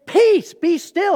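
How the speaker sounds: slow, loud, high command; slow ringing rebuke to the wind; commanding the storm. Retold or imitated. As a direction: order the storm to be still. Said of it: "He spoke to that storm and he said".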